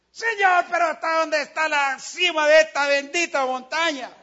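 loud, high desperate shout; exhausted climber shouting to God; shouted cry to God. Retold or imitated. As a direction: yell it up the mountain in exhaustion. Shouting up the mountain.

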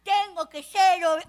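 ordinary delivery

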